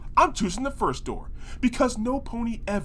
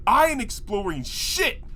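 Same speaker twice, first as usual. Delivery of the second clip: ordinary talk, nothing marked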